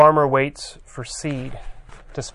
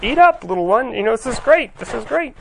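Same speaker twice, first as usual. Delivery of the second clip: much higher, gloating giant coaxing a meal; a giant coaxing children to eat, higher-pitched and hammed-up; mock giant; 9 semitones higher than usual. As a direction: play the giant fattening up the kids, pitched way up. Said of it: "kids are about to get eaten by giants".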